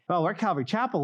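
usual speaking voice

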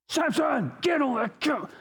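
high sharp yell across the yard at a disobedient dog; exasperated owner yelling for the dog; angry call to the dog. Retold or imitated. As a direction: call the dog in with real irritation. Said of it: "there was anger".

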